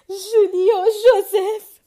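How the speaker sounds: tears of joy cracking the words; trembling cry. A narrator's reading. Mother announcing the engagement, crying with joy.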